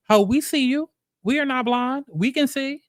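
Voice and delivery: high, sassy call-out; calling them out. Real, not acted. Calling out the cast, sassy and high.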